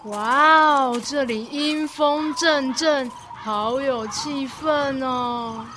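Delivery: drawn out, relishing the eerie mood; slowly, as if soaking in the chill; slow spooky savoring. Acted. Spooky old dorm. (慢慢地、享受阴森气氛)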